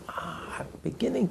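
soft unvoiced whisper, dawning realisation; hushed whisper of someone waking up; whispered admission. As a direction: breathe it like a secret just understood. Person waking up, admitting.

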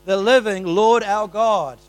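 loud, slow, high preaching of the psalm; fervent loud sermon reading; preaching at full force. Acted. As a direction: proclaim it to the whole hall. Reads scripture with preaching force.

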